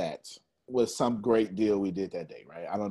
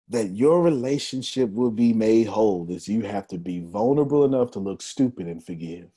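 usual speaking voice

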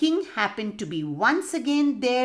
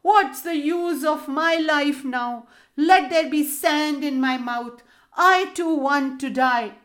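loud, high, mock-despairing wail; wailing yell; 6 semitones higher, 4 dB louder than usual. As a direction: howl it like a grieving madman. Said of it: "yelling out".

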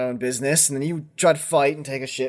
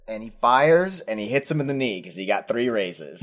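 ordinary talk, nothing marked

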